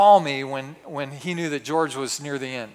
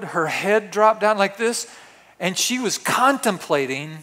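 ordinary delivery

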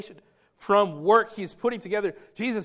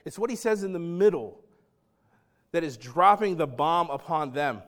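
ordinary delivery

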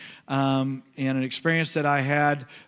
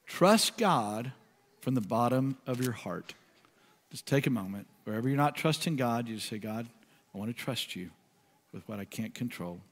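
slow, softer and softer prayerful reading; slow meditative reading; 5 semitones lower, 7 dB quieter than usual. Acted. Pastor guiding the congregation to pray, soft and slow. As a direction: guide them into quiet prayer, slowly. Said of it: "I'm gonna read it slowly".

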